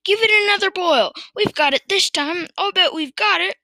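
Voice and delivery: high, exuberant urging; excited shouting. A narrator's reading. Farmer sure of success this time, shouting excitedly. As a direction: cheer the brew on confidently. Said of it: "shouted Mister Cranky".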